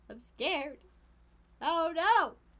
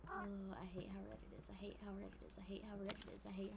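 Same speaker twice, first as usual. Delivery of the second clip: whisper; 4 semitones lower, 14 dB quieter, much faster than usual. Real, not acted. Muttering about the red room.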